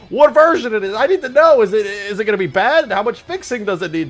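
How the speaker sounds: high, loud, building to a shout; frantic rant. Pretend. Streamer desperate to know which version, frantic rant. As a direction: work up into a hammed-up frenzy.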